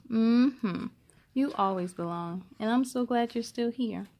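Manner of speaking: ordinary delivery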